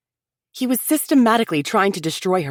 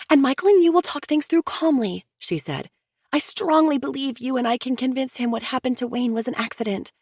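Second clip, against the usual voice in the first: higher, earnest sister-in-law pleading for unity; Chelsea, insistent; 9 semitones higher than usual. A narrator's reading. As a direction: press them to sit together like family. Chelsea voiced, proposing dinner.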